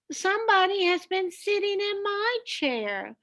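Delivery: a lightly voiced mama bear, miffed about her chair; middle-sized bear voice. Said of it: "in her middle-sized voice".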